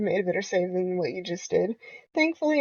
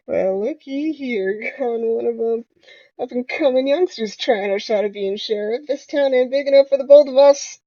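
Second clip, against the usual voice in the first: bad southern accent; 4 dB louder than usual. Playing sheriff to McCree, really bad southern accent. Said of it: "Starts speaking in a really bad southern accent".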